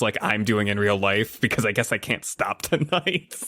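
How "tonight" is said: ordinary delivery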